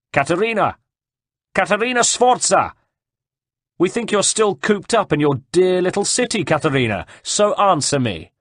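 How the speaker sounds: high, loud taunting call to someone far off. A narrator's reading. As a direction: call up at the walls, pitched high. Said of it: "raised his voice".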